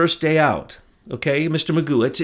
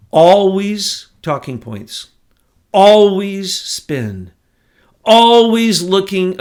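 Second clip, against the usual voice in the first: indignant; 5 semitones higher, 8 dB louder, slower than usual. Real, not acted. Angry rant against Sessions.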